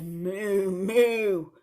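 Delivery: loud double moo; drawn-out pretend moos; cow moo. A narrator's reading. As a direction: imitate a cow mooing. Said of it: "the duck on the duck pond said".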